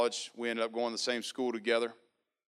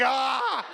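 a high, loud cry of despair; high yelp of dismay; 5 dB louder than usual. Retold or imitated. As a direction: shriek like you've been trapped. Dismay at another phone reading.

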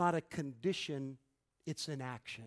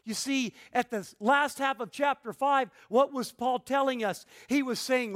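raised, emphatic pulpit delivery; forceful preaching; 6 semitones higher, 8 dB louder than usual. Real, not acted. Preacher telling congregation what Paul taught, forceful preaching. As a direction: preach it higher and louder, punching key words.

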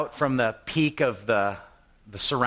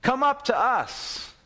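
taunting Philistines; 12 semitones higher, slower than usual. Retold or imitated. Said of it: "If they say thus".

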